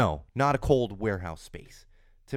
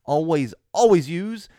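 louder, higher announcer outro; podcast sign-off; 6 semitones higher, 6 dB louder than usual. Acted. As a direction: sign off the show like a host hyping the crowd.

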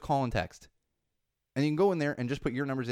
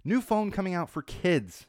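usual speaking voice

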